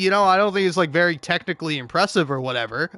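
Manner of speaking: ordinary talk, nothing marked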